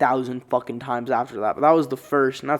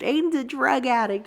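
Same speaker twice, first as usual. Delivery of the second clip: mock mom voice; 11 semitones higher than usual. Mom on the phone to relatives.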